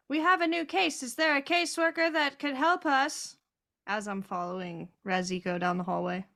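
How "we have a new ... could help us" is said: raised, higher voice calling out; very loud announcement. Acted. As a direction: call down a long hallway for help. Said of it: "I am going to very loudly say".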